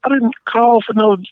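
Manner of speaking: mock outrage, voice climbing; exasperated retort. Retorting to pushy phone caller.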